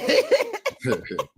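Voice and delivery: sudden high-pitched burst of laughter. Real, not acted. Amused by a viewer's question, high laughter. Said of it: "laugh at question".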